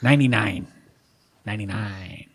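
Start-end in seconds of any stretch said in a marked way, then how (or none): 1.4-2.3 softly to himself; quiet muttered repeat. Real, not acted.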